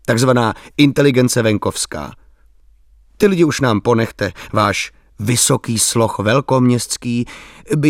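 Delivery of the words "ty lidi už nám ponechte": ordinary delivery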